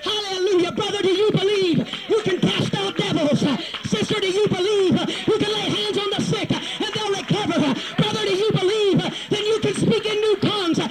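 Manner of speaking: high, driving revival challenge; full-force preaching. Real, not acted. Preacher challenging brothers and sisters to believe, full-force preaching. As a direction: fire each question at the congregation in rhythm.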